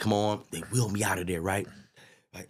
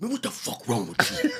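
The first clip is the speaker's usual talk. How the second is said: higher, indignant cussing; Big E cussing; 11 semitones higher than usual. Retold or imitated. Big E cussing him out from the gurney, indignant. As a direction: bark it out high and fed up. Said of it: "He's like".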